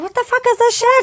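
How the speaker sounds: very high-pitched comic outrage; mock disbelief. Mum baffled by her own tears, mock disbelief. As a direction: squeak it out in mock dismay.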